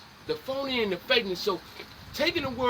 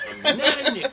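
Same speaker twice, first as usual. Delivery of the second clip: higher and louder, laughing through it; hammed-up indignant repeat with a laugh; laughing mock protest; 6 semitones higher, 6 dB louder than usual. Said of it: "Don't ever call".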